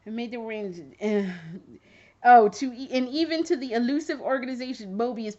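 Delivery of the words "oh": ordinary talk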